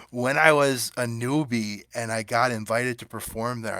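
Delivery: ordinary talk, nothing marked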